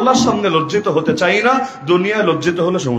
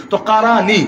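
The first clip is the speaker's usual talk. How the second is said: ordinary talk, nothing marked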